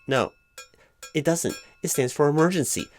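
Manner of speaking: usual speaking voice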